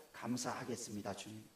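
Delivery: soft, low, devout prayer voice; quiet prayer. Shown as a demonstration. Pastor showing the congregation a soft prayer of thanks. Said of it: "저를 따라합시다".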